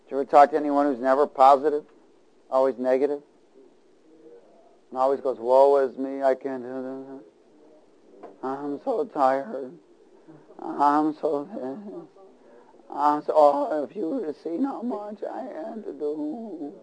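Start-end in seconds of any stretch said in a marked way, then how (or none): 5.4-16.7 slow, droopy, sad-sack whine; whiny hound dog. Retold or imitated. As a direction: moan it like a tired basset hound. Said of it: "Sound like a hound dog".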